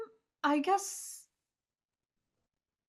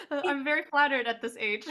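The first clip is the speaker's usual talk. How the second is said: laughing through it, pleased; flattered laugh; 9 semitones higher, 11 dB louder than usual. Real, not acted. Pianist laughing at being told she looks young. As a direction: giggle off the compliment, high and bright. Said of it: "you look so young".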